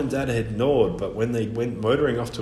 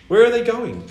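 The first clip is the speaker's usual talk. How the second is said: high, louder worried question; anxious boy; 11 semitones higher, 7 dB louder than usual. Acted. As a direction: ask urgently like a child.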